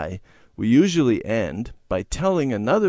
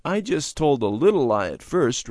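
mildly unhappy confession; rueful, higher-pitched admission; 5 semitones higher than usual. A narrator's reading. Randy explaining his lies to his parents, unhappy. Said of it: "Randy said unhappily".

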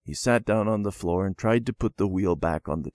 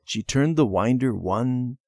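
ordinary delivery, nothing marked

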